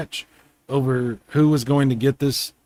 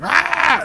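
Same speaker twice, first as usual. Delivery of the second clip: short, much higher yelp like a startled cat; mock cat scream; 4 dB louder than usual. Owner imitating his cat's startled scream, high yelp. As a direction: squeal like a cat spooked by your voice. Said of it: "he'll, like, scream".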